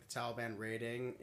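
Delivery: usual speaking voice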